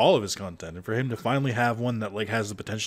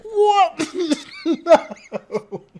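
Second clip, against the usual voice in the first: high, loud laughing shock; laughing disbelief; 11 semitones higher, 6 dB louder, much slower than usual. Real, not acted. Retelling the loyalty video to a friend, laughing high and loud. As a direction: crack up in disbelief.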